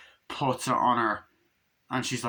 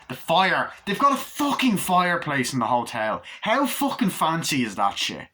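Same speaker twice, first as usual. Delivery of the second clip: louder, higher exclamation; comic amazement; 5 semitones higher, 3 dB louder than usual. Real, not acted. Reviewer marvelling at a fireplace in the hotel, loud amazement. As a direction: burst out, hugely impressed.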